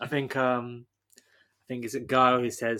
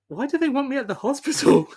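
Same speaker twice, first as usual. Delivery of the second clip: mock bewildered; 10 semitones higher, 3 dB louder than usual. Guile wondering why the hospital called, mock confusion. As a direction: play the clueless hero. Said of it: "your thought was".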